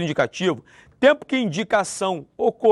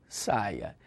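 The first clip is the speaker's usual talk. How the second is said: much lower, much softer "Saia" as a plea; pleading; 10 semitones lower, 12 dB quieter than usual. Shown as a demonstration. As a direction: beg softly, "Saia". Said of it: "Eu posso falar assim".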